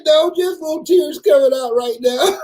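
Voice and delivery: high, whimpering protest; whiny self at dentist. Retold or imitated. Telling the dentist's assistant he is not crying, high and whiny. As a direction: play down the tears. Speaker voices himself at dentist.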